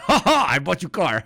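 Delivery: loud, high laughing broken English; mock proud Putin; imitating Putin laughing. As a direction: gloat through laughter. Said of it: "And he's like".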